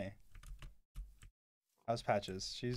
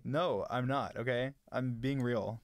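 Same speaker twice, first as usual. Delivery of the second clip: usual speaking voice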